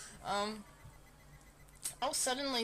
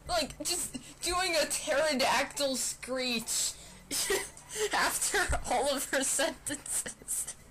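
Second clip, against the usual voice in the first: laughing; 6 semitones higher than usual. Real, not acted. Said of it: "laughing while explaining".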